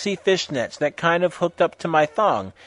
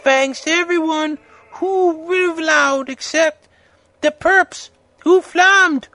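very high, loud mock author voice; put-on fanfic author voice; 14 semitones higher, 5 dB louder than usual. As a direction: pitch it way up for a ranting author's note.

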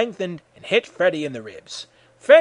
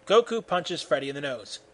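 ordinary delivery, nothing marked

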